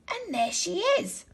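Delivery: a tiny cub pointing her out; Baby Bear spotting Daisylocks in his bed, small and high; little Baby Bear voice. A narrator's reading. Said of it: "in his little voice".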